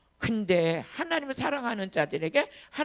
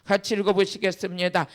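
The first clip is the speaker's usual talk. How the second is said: normal talking voice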